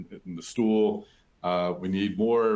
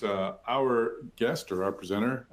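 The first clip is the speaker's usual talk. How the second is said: ordinary delivery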